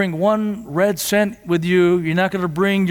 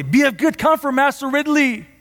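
crying out; 7 semitones higher than usual. Retold or imitated. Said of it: "Latimer cried out to Ridley".

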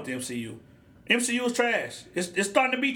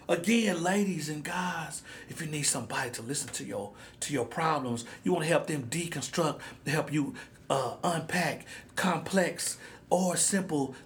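smooth late-night radio voice; 6 semitones lower, 7 dB quieter than usual. Acted. Podcast host offering to be the listeners' mentalo, in a smooth hushed voice. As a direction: lower and softer, sinking to a whisper.

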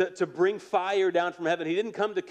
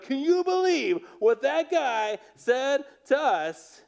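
high, slow whiny tantrum voice, much higher; kid throwing a fit; 9 semitones higher, 4 dB louder, slower than usual. Retold or imitated. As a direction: whine it like an indignant child stomping mad. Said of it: "a kid throwing a fit".

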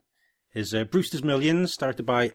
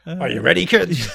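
pirate captain call; 5 semitones higher, 7 dB louder than usual. Retold or imitated.

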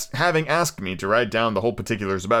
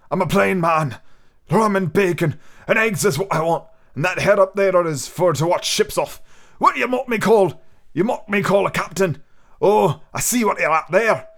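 higher, louder gruff pirate voice; gruff old captain; 6 semitones higher, 3 dB louder than usual. Acted. Old captain ordering rum from the innkeeper, gruff. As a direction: bark it like a seasoned buccaneer.

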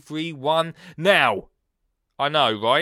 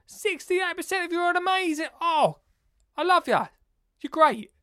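high, excited praise; hyped shout-out; 13 semitones higher than usual. Pretend. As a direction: gush over the listeners.

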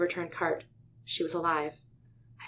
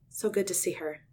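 usual speaking voice

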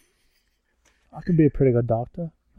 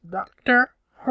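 very high, slow, drawn-out cry; mock Dalek question; 13 semitones higher than usual. Villains asking who the Doctor is, mock high chant. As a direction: stretch each word in a put-on high voice. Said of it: "and they're like".

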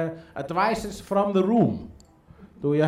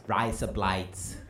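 put-on Australian accent; 7 semitones lower, 7 dB quieter, slower than usual. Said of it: "You can say".

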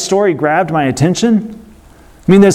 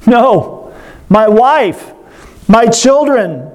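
higher retold cry of terror, still restrained; a prisoner's cry for his family, voiced higher; cried out in terror; 7 semitones higher, slower than usual. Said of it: "he cried out in terror".

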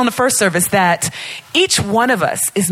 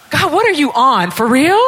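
higher-pitched playful disbelief; incredulous; 6 semitones higher, 3 dB louder than usual. Acted. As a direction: question God in mock amazement, voice rising. Acts out disbelief at God's promise.

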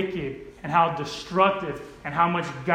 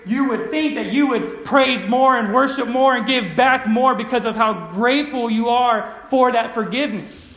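raised, impassioned preaching; forceful preaching; 4 semitones higher, 4 dB louder than usual. Real, not acted. Preacher voices Jesus' rebuke to Simon, forceful preaching. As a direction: drive it home to the congregation.

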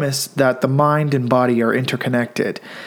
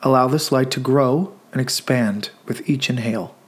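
guided meditation. Acted. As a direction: gently guide listeners into stillness, leaving room to breathe.

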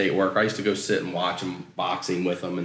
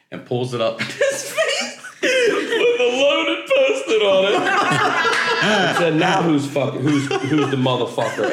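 higher and louder, cracking up; laughing while telling; 12 semitones higher, 5 dB louder than usual. Real, not acted. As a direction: push through the punchline mid-laugh. Punchline told through laughter.

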